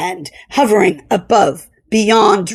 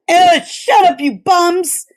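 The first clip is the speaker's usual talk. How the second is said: higher, good-natured brush-off; dismissive cook; 7 semitones higher than usual. A narrator's reading. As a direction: wave them off. Cook waves off the crew.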